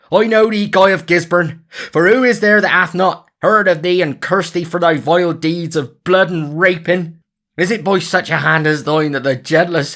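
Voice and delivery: Little John cursing Guy of Gisborne, furious. Acted. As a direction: hurl curses at the killer. Little John curses the villain.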